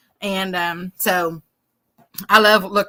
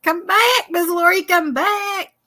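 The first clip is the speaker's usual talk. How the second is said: high mock pleading call; 9 semitones higher, slower than usual. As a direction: playfully beg her to come back, high. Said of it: "It's like".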